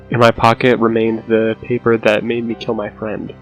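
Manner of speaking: plain reading voice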